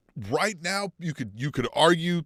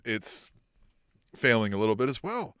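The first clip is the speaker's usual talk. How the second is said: ordinary delivery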